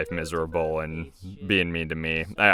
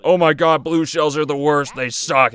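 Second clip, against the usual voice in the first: higher, louder mock outrage; mock complaining; 8 semitones higher, 5 dB louder than usual. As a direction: imitate whiny gamers griping, pitched up. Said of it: "people are like".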